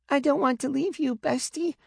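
put-on a small child pleading with her big brother; high child voice, tearful; little girl.

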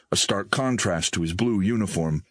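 ordinary delivery

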